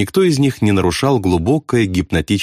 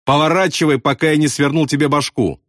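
mildly furious, higher-pitched threat; understated rage in a raised pitch; 6 semitones higher than usual. A narrator's reading. Bombardier threatens pilot to turn, mild fury. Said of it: "остервенился Ясариан".

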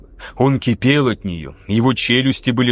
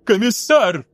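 higher, outraged; indignant cry; 7 semitones higher, slower than usual. A narrator's reading. Lieutenant outraged at the commissar's verdict, indignant cry. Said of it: "возмутился Паката".

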